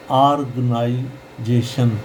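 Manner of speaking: slow "aarg-naai-jeshan", split up; organization syllable by syllable. Shown as a demonstration. Teacher sounding out organization for learners, slow split syllables. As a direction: sound out organization slowly with open AAR. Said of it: "इसको आर पढ़ेंगे".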